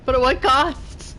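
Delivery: mock dramatic. Shown as a demonstration. Streamer mocking own survival, mock dramatic. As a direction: ham it up like a movie hero. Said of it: "repeats the line dramatically".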